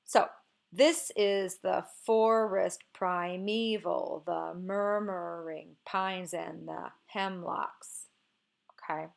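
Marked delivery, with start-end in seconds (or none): 0.7-8.1 slow scansion, THIS is the FORest priMEval the MURmuring PINES and the HEMlocks; slow DUM-da-da metrical reading; dactylic stress. Shown as a demonstration. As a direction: draw out each stressed beat from "This" to "hemlocks", two light syllables after. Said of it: "begins with a stressed syllable, is followed by two unstressed".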